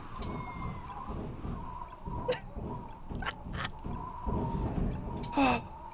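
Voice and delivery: high silent wheeze of laughing; helpless breathless laughing fit; wheezing laughter. Real, not acted.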